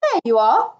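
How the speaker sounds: loud, high, showing off; crowing reveal; proud cry. A narrator's reading. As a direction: present it with a triumphant flourish. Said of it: "cried the Toad".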